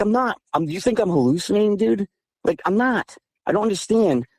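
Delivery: high-pitched defensive disbelief; retold indignant protest. Teller's side of acted quarrel.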